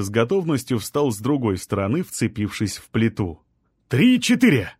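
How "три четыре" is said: high, loud count straining; shout. A narrator's reading. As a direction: shout the count while lifting. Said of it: "крикнул Артём".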